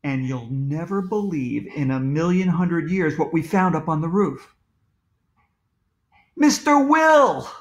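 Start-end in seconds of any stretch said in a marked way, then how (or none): 6.4-7.5 high, loud, drawn-out exclamation; astonished cry. A narrator's reading. Children find Mister Will on the roof, astonished. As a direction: cry the name out in amazement. Children discover the missing man.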